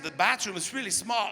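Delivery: ordinary talk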